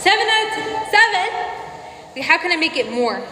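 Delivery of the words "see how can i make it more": ordinary talk, nothing marked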